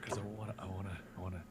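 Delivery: hushed, low-energy phone voice; softly, under your breath; talking quietly. Real, not acted. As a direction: keep it down so nobody wakes. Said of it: "Why are you talking so quiet?".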